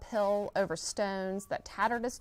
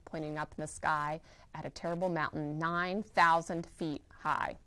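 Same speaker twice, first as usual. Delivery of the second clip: plain reading voice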